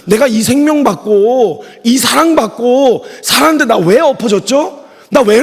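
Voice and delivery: slow, high, anguished self-reproach; chest-beating lament. Retold or imitated. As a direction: wail it like someone tearing at their chest. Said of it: "가슴을 찢으면서". (가슴을 찢으며 천천히 높게 탄식하듯)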